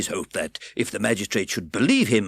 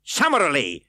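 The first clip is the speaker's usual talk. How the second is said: curt; 7 semitones higher, 8 dB louder than usual. A narrator's reading. Fang curt.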